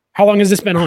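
alarmed husband asking his wife in disbelief; incredulous. Retold or imitated. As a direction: ask it like you can't believe it. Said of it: "I'm like".

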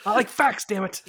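loud, high protest through laughter; laughing self-defense. Real, not acted. Co-host teased for being a nerd, laughing loud protest. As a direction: protest while cracking up, pitch jumping.

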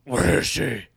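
a lightly voiced caped hero; Batman voice. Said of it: "give us your best fucking Batman".

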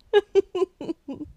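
high laugh. Real, not acted. Laughing at own sassy line.

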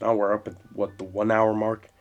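ordinary talk, nothing marked

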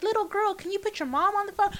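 high mock talking-to-a-child voice; imitated phone agent. Agent asking a little girl for her mom, mock condescending. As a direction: talk down as if to a small child. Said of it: "But they're like".

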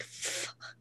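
breathy whispered curse. Retold or imitated. Retelling reaction to a text.

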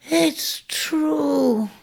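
a weak croak from a dying ram; hoarse croak. Acted. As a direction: rasp it out with no strength left. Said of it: "croaked Ares from aloft".

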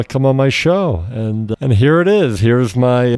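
ordinary talk, nothing marked

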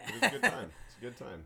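laughing. Real, not acted. Laughing about drunken night.

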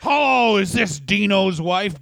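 a prank call, hammed-up and cruel; mock prank caller. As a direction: call the widow and taunt her. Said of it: "Ring, ring".

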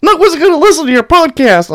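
high whiny mock of a hater. Mimicking a hater dismissing his podcast in a high voice. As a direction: sneer it up high like a doubter. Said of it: "people are like".

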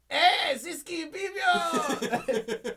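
high, drawn-out holler, imitating a buddy; mock party greeting yelled at full volume; slow, high whooping shout of hello.

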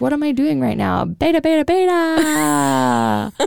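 high peppy chant; sing-song cheerleader beta chant. Retold or imitated. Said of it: "I'm like".

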